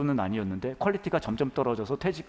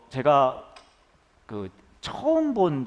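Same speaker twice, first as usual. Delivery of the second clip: normal talking voice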